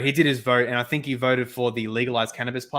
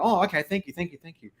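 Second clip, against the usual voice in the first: mock enthusiastic thanks; 5 semitones higher than usual. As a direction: imitate a cousin thanking the campaigners too eagerly. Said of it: "kinda enthusiastic and kinda, like, nice".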